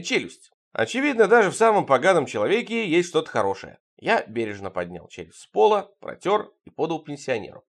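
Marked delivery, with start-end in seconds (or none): none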